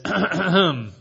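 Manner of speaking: hesitant, put-on throat clear; acted timid "ahem"; tentative throat-clearing. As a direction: clear your throat before daring to pipe up. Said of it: "and he pipes up".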